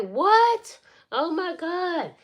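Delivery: high, loud "What?" then a drawn-out gasp of dismay; stunned outburst, stretched and high; shocked disbelief. Retold or imitated. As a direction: imitate a stunned sister, pitch shooting up. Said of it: "She could not believe it".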